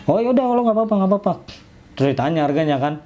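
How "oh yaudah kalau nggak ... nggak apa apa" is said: imitating shopkeeper. Imitating shopkeeper's gentle reply.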